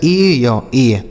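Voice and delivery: drawn-out first vowel sliding into "ya" in ए या ए; teacher sounding out ए या ए piece by piece; vowel glide demo. Shown as a demonstration. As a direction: split the glide into parts, then join it as ए. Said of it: "क्या हो जाता है?".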